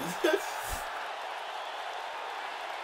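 breathy laugh. Real, not acted. Laughing at joke.

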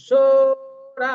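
সোরা slow, both vowels drawn out; long vowels. Shown as a demonstration. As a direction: draw out সোরা syllable by syllable. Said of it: "সু এবং রা দুটোই দীর্ঘ হয়".

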